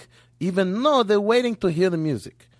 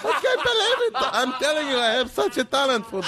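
laughing; 11 semitones higher than usual. Real, not acted. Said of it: "laughing at own joke".

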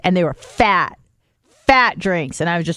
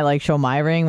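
ordinary talk

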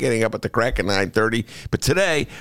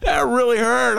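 mock pain; 10 semitones higher, much slower than usual. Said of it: "I'm tearing up".

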